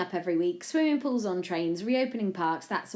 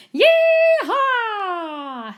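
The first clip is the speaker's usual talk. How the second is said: high, loud, drawn-out whoop; full-out cowboy holler; cowboy yell; 13 dB louder than usual. A narrator's reading. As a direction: holler it like a rodeo rider. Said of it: "he yelled".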